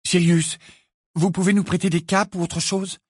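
high, hurried pleading; begging for cloaks; feverish plea. A narrator's reading. Ginny begs Sirius for cloaks, feverish and high. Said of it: "d'une voix fébrile".

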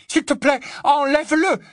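very high, loud pleading; strangely high voice. Acted. Hermione begs Ron to take it off, high-voiced. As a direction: beg urgently at the top of the voice's range. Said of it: "la voix étrangement aiguë".

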